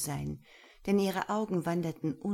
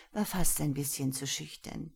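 plain reading voice

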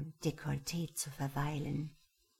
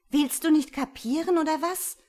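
furious, much higher, much louder yell; angry shout; 11 semitones higher, 10 dB louder than usual. Acted. Patricia yelling at the stranger who won't listen, furious. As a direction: blast it at him, out of patience.

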